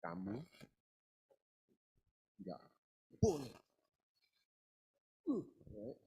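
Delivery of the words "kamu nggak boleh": Indonesian words set apart, "boleh" hit hardest; word-by-word scolding beats; chopped emphasis. Shown as a demonstration. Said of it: "I'll do it in Indonesian".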